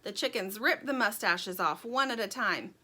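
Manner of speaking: ordinary delivery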